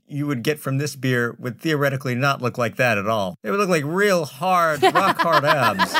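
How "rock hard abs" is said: high, drawn out, laughing; emphatic. Acted. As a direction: punch out rock hard abs.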